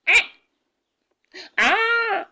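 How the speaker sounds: high, sustained mock wail; loud, high-pitched baby wail; imitated baby cry. Imitates a hungry baby's cry.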